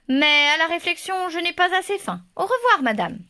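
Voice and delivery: wolf voice. A narrator's reading. Wolf declines, moved.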